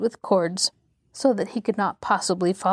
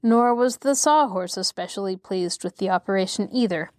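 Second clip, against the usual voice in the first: plain reading voice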